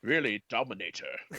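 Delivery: slurred-s Scottish film-star impression; Sean Connery. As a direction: turn every s into "sh", like an old screen Scot. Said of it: "he's just being Sean Connery".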